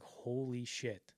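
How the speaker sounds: slow, hushed imitated amazement; retold awe. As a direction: draw it out softly, quietly floored. Director awestruck by the audition.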